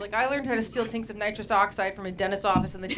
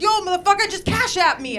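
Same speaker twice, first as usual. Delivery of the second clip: louder, higher tough-guy panhandler; mock aggressive demand; 7 semitones higher, 7 dB louder than usual. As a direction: bark it like a pushy beggar. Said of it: "They get super aggressive".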